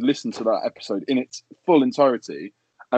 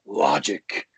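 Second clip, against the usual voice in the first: lightly voiced [name] saying the name; a hint of Budden calling him out by name; [name] impression; 3 semitones higher than usual. Podcaster mocking [name], a mild Budden impression. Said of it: "I can't do the impression 'cause I just end up sounding like Alex Jones".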